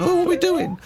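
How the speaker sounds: high panicky cartoon voice; panicking bee. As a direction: squeal it like a falling cartoon bee. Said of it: "they all fall out the sky going".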